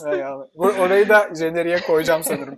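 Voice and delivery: talking through leftover laughter; after laughing hard. Real, not acted. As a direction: still laughing, breathless. Right after a long laugh. (kahkahadan sonra gülerek)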